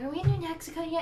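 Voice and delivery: high, eager nagging; pestering. Acted. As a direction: pester like a kid in the back seat. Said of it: "bug the hell out of Bronny".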